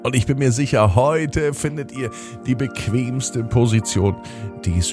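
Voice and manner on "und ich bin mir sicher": usual speaking voice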